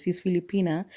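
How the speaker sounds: usual speaking voice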